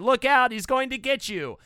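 audience screaming. Retold or imitated. Retelling how crowds yelled at the Predator screen, mock yell. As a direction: call out a warning like a moviegoer. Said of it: "They were screaming at the screen".

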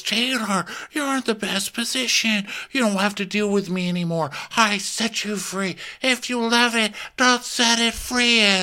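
high mock-earnest Shep voice. Podcaster imitating Shep lecturing Taylor, high mock-earnest voice. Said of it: "And Shep goes".

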